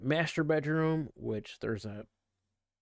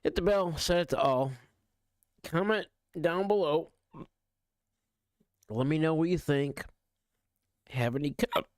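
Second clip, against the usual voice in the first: YouTuber outro; 4 semitones higher than usual. Acted. Outro call to action again.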